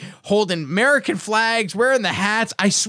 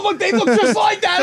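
higher and louder, caught up in amazement; high and loud, amazed at the parade; excited disbelief; 13 semitones higher, 5 dB louder than usual. Real, not acted. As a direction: tell it like you still can't believe it.

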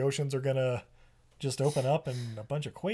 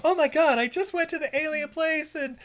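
much higher, bubbly gushing voice; super perky; 11 semitones higher than usual. Retold or imitated. Claire telling the captain her good news, super perky. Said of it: "she's super perky".

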